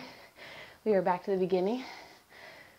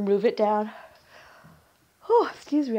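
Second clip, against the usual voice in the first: a real yawn, pitched up and louder; 5 dB louder than usual. Real, not acted. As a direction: let a yawn break through the words. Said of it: "I'm yawning".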